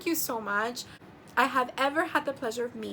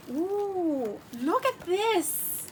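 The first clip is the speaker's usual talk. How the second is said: delighted ooh; 6 semitones higher, much slower than usual. Real, not acted. Admiring the notebook.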